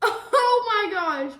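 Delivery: delighted laughing cry; laughing exclamation. Real, not acted. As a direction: laugh into a loud slow oh my gosh. Laughing at bubbles.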